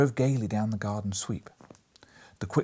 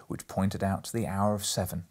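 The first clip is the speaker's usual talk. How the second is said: ordinary delivery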